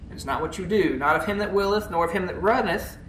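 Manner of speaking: ordinary delivery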